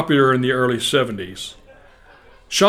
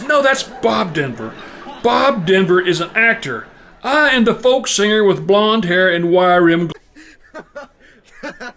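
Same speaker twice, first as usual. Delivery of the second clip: raised, insistent mock exasperation; exasperated caller; 6 semitones higher, slower than usual. Singer explains who he is to mother, exasperated correction. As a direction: spell it out in frustration, pitched up. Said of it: "the exasperated voice says".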